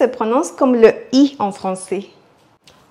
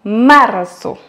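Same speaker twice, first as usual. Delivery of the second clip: Marzo with the first A hit hard and louder; stress on A; 4 dB louder than usual. Shown as a demonstration. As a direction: punch MAR in Marzo. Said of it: "accentuer sur le A".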